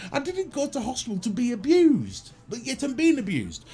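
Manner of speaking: mock outrage. Real, not acted. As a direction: play the wronged victim.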